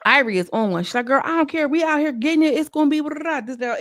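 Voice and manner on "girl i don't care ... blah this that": putting on a show. Retold or imitated. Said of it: "Ivory was kinda putting on a little bit".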